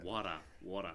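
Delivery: water said wah-tuh, no R, open vowel; mock Aussie water, dropping the R; water with an Australian vowel. Shown as a demonstration. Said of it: "That's a Australian".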